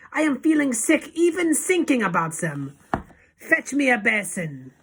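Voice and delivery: high, loud revolted rant; Grand High Witch scream. A narrator's reading. Sickened witch leader demanding a basin, high scream. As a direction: keep screaming, then order sharply. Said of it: "screamed the Grand High Witch".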